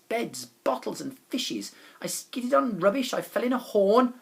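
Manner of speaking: ordinary delivery, nothing marked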